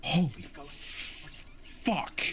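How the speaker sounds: startled hushed whisper; breathless whisper. Real, not acted. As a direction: gasp it out in shock. Jump scare reaction.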